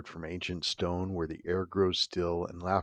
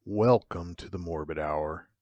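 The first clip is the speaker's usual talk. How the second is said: ominous host intro; 4 dB quieter than usual. Acted. Horror host welcoming listeners to the Morbid Hour, hushed and ominous. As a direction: draw the listener into the dark.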